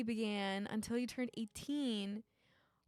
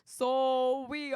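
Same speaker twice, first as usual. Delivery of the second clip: loud, failed try at Minnesotan; Minnesotan accent; 7 dB louder than usual. Acted. Game player giving up on the story, attempted Minnesotan accent. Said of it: "I can't even do a Minnesotan".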